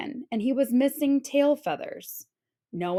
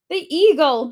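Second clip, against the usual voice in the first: alarmed, louder and higher; frightened cry; 5 semitones higher, 8 dB louder than usual. A narrator's reading. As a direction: cry out at the shadow overhead. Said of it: "she cried".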